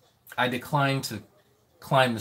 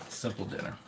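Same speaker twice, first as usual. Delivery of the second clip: much softer, almost whispered; quiet aside; 3 semitones lower, 10 dB quieter than usual. Real, not acted. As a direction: mutter it to yourself. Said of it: "Yeah, this is a simple dinner".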